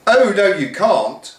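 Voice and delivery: slow sing-song pantomime reply; whole school chanting; chiming crowd. Acted. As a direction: chant it back like a panto audience. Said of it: "chimed the entire school".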